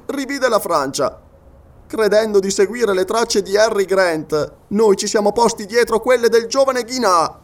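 high, sustained, projected character voice; scientist's grave declaration. Acted. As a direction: declaim the bad news to your friends, pitched above the narration.

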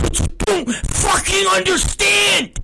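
high, strained re-enacted yell; retold fury. Dad re-enacting his phone rant as kids are walked away, furious. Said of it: "I was mad".

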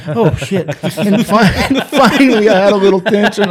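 laughing harder as the story builds. Real, not acted. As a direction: crack up while retelling your own panic. Laughing while retelling nervous fumbling.

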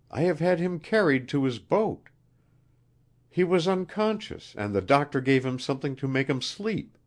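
high-pitched, earnest queen; anxious queen. A narrator's reading. Queen explaining what she did with him, anxious.

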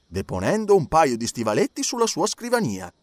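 ordinary delivery

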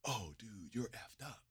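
unvoiced whisper to a friend; whispered in class. Buddy whispering across the classroom, hushed. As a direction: whisper it so the teacher can't hear. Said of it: "He's like".

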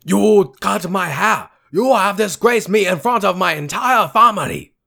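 hammed-up outraged samurai. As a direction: wail of dishonour in a mock samurai film. Mock samurai duel skit.

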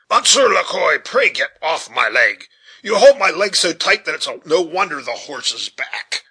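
loud, exasperated demand, starting high and settling; agitated. A narrator's reading. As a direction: snap loudly at a clinging passenger. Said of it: "too much agitated to regard his pronunciation".

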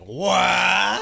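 high, loud, drawn-out what; rising cry of disbelief; shocked what. Retold or imitated. Friend retells hearing the [name] claim, shocked.

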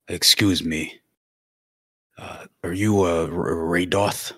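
a timid, halting Mooney; hesitant in character. Acted. Mooney nervously asks the druid for help, halting and timid. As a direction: stumble through the greeting shyly.

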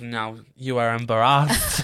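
slow, laughing mock outrage; laughing quote. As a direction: quote her while cracking up. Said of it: "she goes".

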